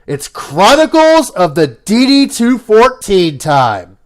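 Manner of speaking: ordinary talk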